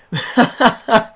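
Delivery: loud burst of laughter. Real, not acted. Commentator amused by the keeper's mistake, loud laugh. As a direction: crack up at the blunder.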